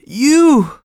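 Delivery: high, loud, astonished shout; joyful cry. A narrator's reading. The farmer welcoming a boy he thought dead, shouting with joy. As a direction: burst out with the word in tearful delight. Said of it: "he cried".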